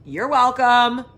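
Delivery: mock gracious. Teasing viewers after showing feet.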